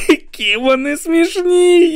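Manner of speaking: very high, slow, cry-laughing; sobbing with laughter; laughing almost to tears. Acted. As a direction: squeeze the words out through helpless laughter. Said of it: "ледь не плакав від сміху".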